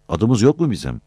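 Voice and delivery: ordinary delivery, nothing marked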